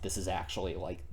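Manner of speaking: normal talking voice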